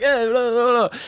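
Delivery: a hint of Kevin Hart hamming it up; mock Mister Funny Guy. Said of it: "Mister Funny Guy".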